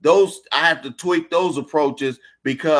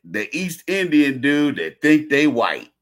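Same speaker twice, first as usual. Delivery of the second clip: usual speaking voice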